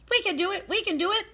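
very high, squeaky mouse chorus; tiny mice voices. A narrator's reading. Mice cheering each other on, high and squeaky. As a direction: squeak it out like eager little mice. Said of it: "said the mice".

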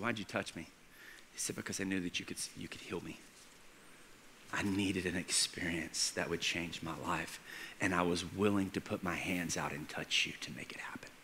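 very quiet, low and intimate; hushed confession. Acted. Healed girl explaining to Jesus why she touched him, hushed. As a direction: tell it softly, leaving room between phrases.

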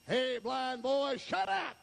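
high acted yell at a beggar; mock heckling; scolding crowd. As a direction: holler him down, rising in pitch.

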